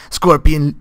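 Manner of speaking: burping mid-word; a burp slips out on "Scorpion". Real, not acted. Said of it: "I burped during my pronunciation".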